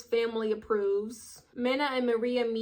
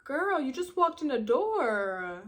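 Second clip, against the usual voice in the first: unimpressed retort; 3 semitones higher, slower than usual. Retold or imitated. Deadpan comeback to pushy roommate.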